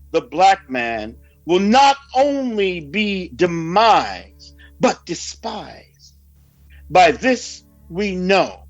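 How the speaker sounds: usual speaking voice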